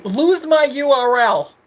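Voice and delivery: slow, loud, sassy brush-off; sassy dismissal. Acted. Telling trolls to leave, sassy brush-off. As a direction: shoo the trolls away with sass.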